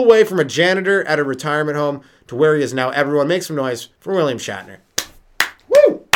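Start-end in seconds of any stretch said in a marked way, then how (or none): 5.7-6.1 loud high whoop; cheering woo. Real, not acted. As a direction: hype up the crowd. Crowd cheer for guest.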